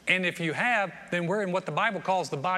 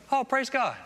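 higher-pitched pleased exclamation; delighted greeting; 7 semitones higher than usual. Acted. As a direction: act out happily discovering a fellow believer. Acted friendly meeting of two believers.

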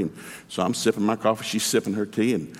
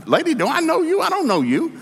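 much higher and louder, baffled; incredulous shout; 3 dB louder than usual. Retold or imitated. Man at the rally shouting, baffled, at a protesting woman. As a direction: call out in disbelief at a stranger.

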